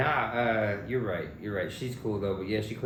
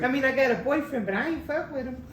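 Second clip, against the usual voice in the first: high mock girl voice; 6 dB louder than usual. Voicing the girl mockingly.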